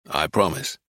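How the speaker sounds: low, quiet reply; grim promise. A narrator's reading. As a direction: give your word grimly, voice dropped. Grim Titus answers the request.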